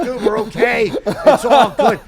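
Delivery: high, laughing dude it's fine; laughing reassurance. Retold or imitated. Retelling reassuring heckler, laughing.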